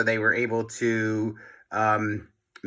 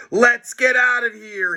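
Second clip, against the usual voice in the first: put-on higher, louder alarmed kid; urgent cry; 12 semitones higher, 4 dB louder than usual. A narrator's reading. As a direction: shout to flee. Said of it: "he cried".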